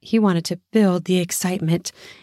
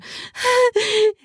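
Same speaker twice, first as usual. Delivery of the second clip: high moan. Acted. Woman's pleasure sound.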